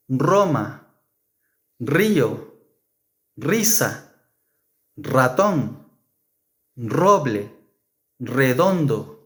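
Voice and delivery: rosa, Roma, ratón starting with a trilled r; rolled r. Shown as a demonstration. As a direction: roll the first r strongly. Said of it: "the initial sound will be a strong R".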